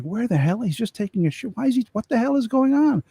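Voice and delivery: wondering where his buddy went; impatient, baffled soldier. Retold or imitated. Soldier waits hours, impatient.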